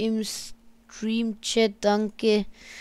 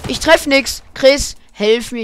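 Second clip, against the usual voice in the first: loud call for help; yelling across the room; 4 semitones higher, 11 dB louder, slower than usual. Real, not acted. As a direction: shout to a teammate. Calls teammate for help.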